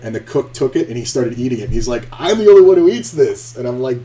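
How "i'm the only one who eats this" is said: loud, high mock exclamation; excited outburst. Cook eating the eyeball beside the diner, excited outburst. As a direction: belt it out as the thrilled cook.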